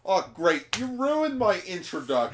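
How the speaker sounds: high, loud mock outrage; exasperated complaint. Doctor Psyche whose entrance was spoiled, exasperated. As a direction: complain loudly about being upstaged.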